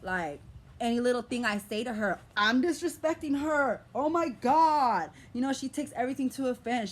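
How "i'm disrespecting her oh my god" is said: louder, slower, fed-up cry; exasperated outburst. Real, not acted. Venting to viewers about her mother, an exasperated louder outburst. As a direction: throw it out, exasperated.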